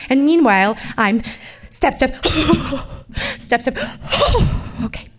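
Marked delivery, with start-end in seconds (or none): 1.7-5.1 huffing steps between sharp gasps; hobbling and panting through the clerk's office; out of breath. Retold or imitated. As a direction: gasp for air on every step.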